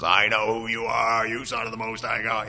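lightly voiced possessed man. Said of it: "possessed man who walks down and says".

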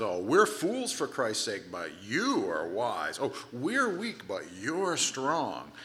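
slow, drawn-out mock humility and praise; swooping, stretched-out irony; sarcastic. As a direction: exaggerate each contrast with heavy sarcasm. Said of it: "he starts getting sarcastic".

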